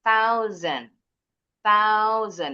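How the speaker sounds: THOUSAND with tongue-tip th and a z; th and z sounds. Shown as a demonstration. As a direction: say THOUSAND slowly and clearly as "thau-zen". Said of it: "bunyi T H itu kayak huruf sa". (THOUSAND, th seperti huruf tsa, s seperti z)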